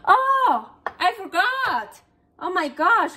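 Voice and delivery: high, loud startled oh; sudden realisation, voice jumps up; surprised exclamation. Real, not acted. Forgot to remove something, exclaims.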